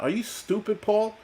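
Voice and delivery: high-pitched real disbelief; incredulous scolding. Real, not acted. Streamer to a commenter praising Putin, incredulous. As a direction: ask it high, as if appalled by the idea.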